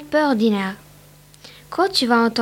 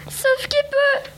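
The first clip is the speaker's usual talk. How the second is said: much higher, slow, strained cry of alarm; panicked yell; 13 semitones higher, slower than usual. A narrator's reading. Rabbit warns his cousin as the carrot appears, panicked yell. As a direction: shriek it as you bolt away. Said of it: "hurla Jeannot".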